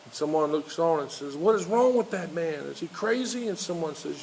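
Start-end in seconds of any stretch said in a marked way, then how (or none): 1.4-3.5 shocked onlooker. Retold or imitated.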